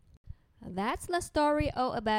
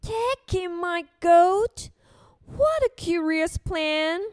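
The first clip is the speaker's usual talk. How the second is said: surprised, louder and drawn out; puzzled old lady; 3 semitones higher, 6 dB louder than usual. Acted. Old lady puzzled by the wise man's advice. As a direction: she can't believe the plan. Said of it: "said the wise old man".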